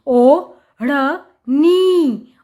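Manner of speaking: ओ, ढ़, नी slow and separate; sounding out. Shown as a demonstration. Teacher showing how it is written, sound by sound. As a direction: split it with pauses: ओ... ढ़... नी. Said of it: "अब ओढ़नी कैसे लिखते हैं".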